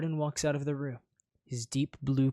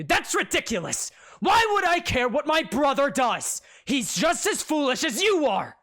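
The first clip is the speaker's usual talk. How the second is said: flustered denial; 12 semitones higher, 8 dB louder than usual. Acted.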